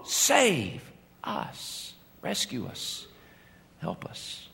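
plea fading down to a whisper. Acted. Crowd's cry for help trailing off, fading to a whisper. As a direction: let the cry die away into a whisper. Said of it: "they were crying out".

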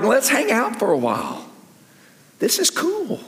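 high, put-on easygoing voice; laid-back disciples. Preacher voices disciples wanting to stay, high mock-cool voice. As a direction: play the impressed hangers-on, pitched right up.

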